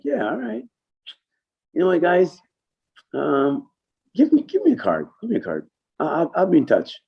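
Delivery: high breezy businessman voice; imitating Kenny. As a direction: play the casual dealmaker up high. Kenny voiced at trade show.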